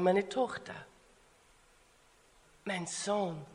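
very soft, low reassurance; tender Jesus. Acted. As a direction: comfort gently, with long pauses. Imagined Jesus comforting a frightened child. (ganz leise und tröstend)

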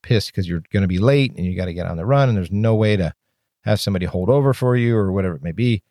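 normal talking voice

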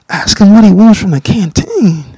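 can't believe what he heard; incredulous. Retold or imitated.

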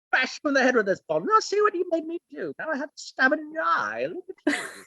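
high, prim posh British voice, cheerfully menacing; posh British accent. Pretend. Actor voicing Art the Clown's inner voice in a posh British accent. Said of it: "posh, uppity accent".

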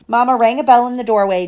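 ordinary delivery

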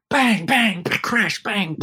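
high, mimicked bangs and crashes; imitated crashing bangs. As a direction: act out the case slamming into walls. Drum case pushed down corridor.

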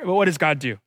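ordinary talk, nothing marked

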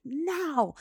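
loud, high imitated toddler no; defiant refusal. Retelling daughter refusing to count, loud mock defiance. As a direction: cry out a mock tantrum refusal.

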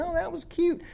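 high, airy mock gush; dismissive. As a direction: toss it off like a bored churchgoer. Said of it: "come to a Bible study and".

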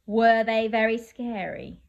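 ordinary delivery

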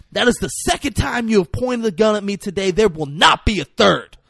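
acted stern warning. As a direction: lay down a final warning at gunpoint. Gun in face, acted warning.

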